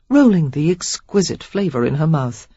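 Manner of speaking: ordinary delivery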